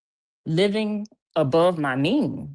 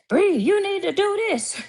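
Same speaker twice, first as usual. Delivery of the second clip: shrill mock voice, much higher and a bit louder; mocking a bossy commenter; 3 dB louder than usual. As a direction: parody a nagging know-it-all.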